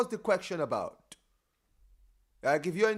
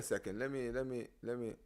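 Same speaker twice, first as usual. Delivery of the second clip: quiet but clear; 7 semitones lower, 9 dB quieter than usual. Real, not acted. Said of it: "you sound quiet".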